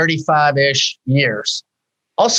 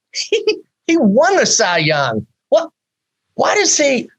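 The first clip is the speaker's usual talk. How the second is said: incredulous disbelief; 8 semitones higher than usual. Acted. Mocking the star's need for a coach.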